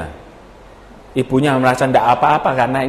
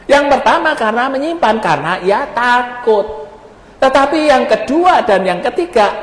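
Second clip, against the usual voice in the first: emphatic preaching; 9 semitones higher than usual. Acted. Preacher contrasting the servants in the parable, emphatic. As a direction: preach with raised pitch, stretching the key words.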